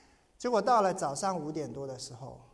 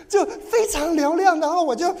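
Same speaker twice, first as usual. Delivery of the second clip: high ringing voice; 10 semitones higher, 10 dB louder than usual. Retold or imitated. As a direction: stretch it out high and bright like the bird. Imitating bright birdsong voice.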